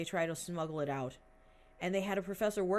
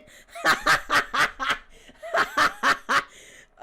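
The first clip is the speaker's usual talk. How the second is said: long, loud, high cackle of laughter; long real laughter; 9 semitones higher, 14 dB louder than usual. Real, not acted. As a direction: let the laugh roll on. Laughs after the punchline.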